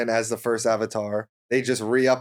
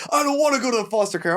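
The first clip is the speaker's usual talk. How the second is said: high, fast whining imitation; mock a whiny grown man; 9 semitones higher, much faster than usual. Mocking the show character.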